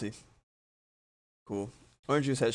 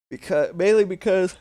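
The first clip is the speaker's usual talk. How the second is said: yawning; 8 semitones higher, 11 dB louder than usual. Real, not acted. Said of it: "yawning so much".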